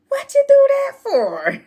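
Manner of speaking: put-on girl yanked out of a tree, protesting; laughing yelp. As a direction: squeal it through laughter.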